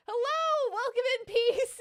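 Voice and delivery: high, loud welcome; loud high-pitched greeting; exuberant greeting. Real, not acted. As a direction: call out a hello way up high. Greeting arrivals to the stream.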